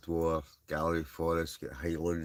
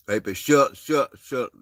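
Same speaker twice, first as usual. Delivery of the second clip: loud, high call across the room; calling to a friend across the room; 7 semitones higher, 11 dB louder, slower than usual. Real, not acted. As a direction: shout his name to get his attention. Calling out to his friend Stuart.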